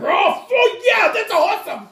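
high, loud, slow, laughing cheer; ecstatic shout. Retold or imitated. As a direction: yell like you just killed it. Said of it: "It's like".

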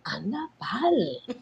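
soft, slow, laughing disbelief; half-laughed, hushed aside; laughing reply. Retold or imitated. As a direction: let it out through a quiet laugh, slowly. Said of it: "y yo".

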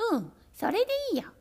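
high, fast, gleeful kittens; happy kittens. A narrator's reading. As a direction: approve it in a rush. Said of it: "喜んで叫びました".

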